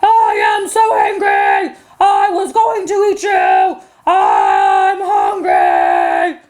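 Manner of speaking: drawn-out, growling troll wail; screaming troll. Acted. Troll swept downriver screams at goats, long angry wail. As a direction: howl it slowly as a thwarted monster. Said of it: "he screamed back at the billy goats".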